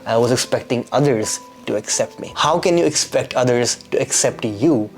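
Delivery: normal talking voice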